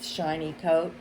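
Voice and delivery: plain reading voice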